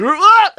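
high, loud comic yell for a plane taking off; mock scream, shooting right up in pitch; imitated take-off scream. Plane takes off from tiny runway, comic scream.

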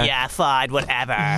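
low, flat and dismissive; can't be bothered, brushing him off; bored sulky kid. Acted. Sulky posh kid character.

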